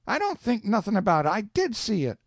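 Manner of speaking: put-on high-pitched, protesting young voice; a boy insisting he's right; indignant boy Huck.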